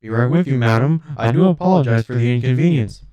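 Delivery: loud metallic voice from another room; echoing, metallic robot butler. A narrator's reading. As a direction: call out as a courteous robot. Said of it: "a metallic voice echoed from the other room".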